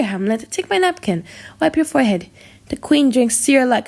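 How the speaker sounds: usual speaking voice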